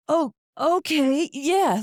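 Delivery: a high, slow imitation of a dazed man; stunned guy. Imitating the slapped guy, high dazed mock voice. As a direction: play him dumbfounded, drawn out.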